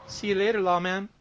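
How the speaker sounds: put-on high, loud girls' voices. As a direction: call it out high as the teasing girls. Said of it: "And they say".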